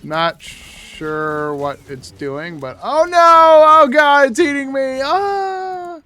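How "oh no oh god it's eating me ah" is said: panicked yelling. Pretend. Boss fight, being eaten.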